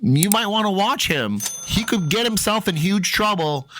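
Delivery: mild mock scream; retold screaming. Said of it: "I'm screaming".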